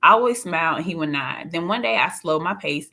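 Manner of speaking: usual speaking voice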